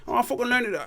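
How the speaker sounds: high, cocky mock boaster; put-on voice. Bragging to a friend, high silly put-on voice.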